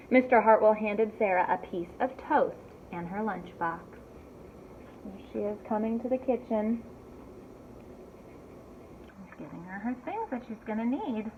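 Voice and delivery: usual speaking voice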